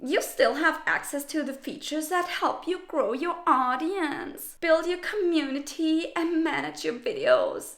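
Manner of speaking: hint of a sweet, snide sorority girl. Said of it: "in this toxic sorority girl voice".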